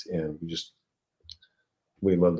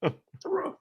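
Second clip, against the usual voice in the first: alarmed whisper; 9 semitones higher, 5 dB quieter, slower than usual. Retold or imitated. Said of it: "and I was like".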